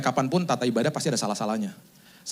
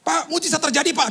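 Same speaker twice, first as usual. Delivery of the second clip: high-pitched excited phone report; excited caller; 11 semitones higher, 4 dB louder than usual. Retold or imitated. Relative phoning the pastor about a miracle, acted excitement. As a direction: imitate a caller bursting with news. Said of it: "kaget loh saya pas telepon".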